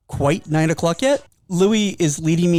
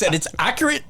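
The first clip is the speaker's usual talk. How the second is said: usual speaking voice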